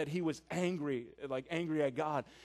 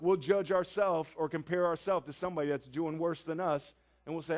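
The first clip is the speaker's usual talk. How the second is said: usual speaking voice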